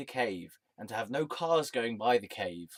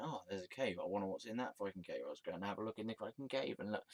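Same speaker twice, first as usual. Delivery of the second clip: softer, fast curious passer-by; nosy jogger; 2 semitones lower, 11 dB quieter, faster than usual. Retold or imitated. Jogger passing the cave, fast quiet nosy voice. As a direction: mutter it quickly like a nosy jogger. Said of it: "running by and going".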